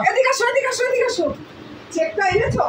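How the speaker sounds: yelling across the room. Acted. Calling wife over, high.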